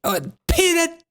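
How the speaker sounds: lightly voiced mock indignation; mock offended official. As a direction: play her as haughty and affronted. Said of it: "how triggered she gets".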